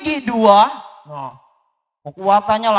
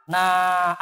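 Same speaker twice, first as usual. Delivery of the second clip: ordinary delivery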